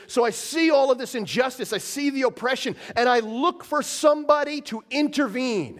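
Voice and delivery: a lightly voiced grieving God; God astonished. God in Isaiah seeking someone to intervene, astonished. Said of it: "he goes".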